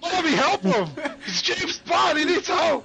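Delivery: high-pitched excited urging; urgent plea. Real, not acted. Player begging Bobby to help James Bond, urgent high call.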